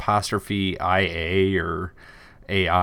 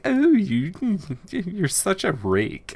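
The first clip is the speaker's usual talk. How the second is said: high, giggly mock flattered queen; playfully hammed-up tittering royal; giggling queen; 9 semitones higher, slower than usual. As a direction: coo it slowly through put-on giggles. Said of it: "the queen's just, like, giggling".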